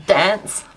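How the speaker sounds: dance said with a flat short a as in cat. Shown as a demonstration. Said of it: "Okay, I say dance".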